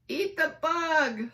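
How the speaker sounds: put-on loud, drawn-out kids' yell; kids yelling. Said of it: "some kids yelled".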